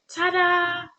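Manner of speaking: bright, high and loud ta-da; showy ta-da; ta-da reveal. A narrator's reading. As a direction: present it like a magic trick. Flora presenting swimming cap.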